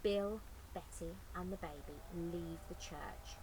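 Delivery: plain reading voice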